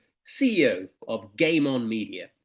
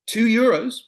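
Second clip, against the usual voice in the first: higher and louder quick reply; higher, louder young seller; eager son; 10 semitones higher, 9 dB louder, slower than usual. Acted. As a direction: blurt the price like a keen boy. Son names price.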